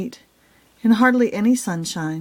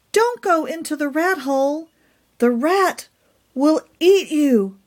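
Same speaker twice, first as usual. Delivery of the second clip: urgent warning; 7 semitones higher than usual. Acted. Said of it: "Pumpkin called from the roost".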